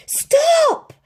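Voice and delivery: very high, loud stop; yell. A narrator's reading. Lady yells at Tramp to stop, loud. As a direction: yell it out sharply. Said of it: "yelled Lady".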